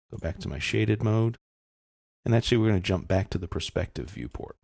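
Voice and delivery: normal talking voice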